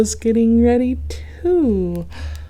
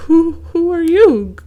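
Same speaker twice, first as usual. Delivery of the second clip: high, loud stuttering fright; scared stammer; 10 semitones higher, 10 dB louder than usual. A narrator's reading. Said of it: "Goose honked".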